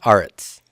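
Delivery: aretz whispered, AH-rets with long A. Shown as a demonstration. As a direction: breathe the word aretz. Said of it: "Eretz would become".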